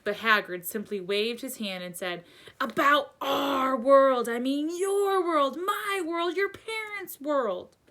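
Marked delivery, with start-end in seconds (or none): 2.6-7.8 high, slow, drawn-out outburst; loud start, stretched in rising exasperation; worked-up exclaiming. A narrator's reading. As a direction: exclaim it urgently, high-pitched.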